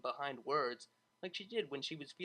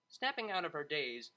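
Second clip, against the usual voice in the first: ordinary delivery, nothing marked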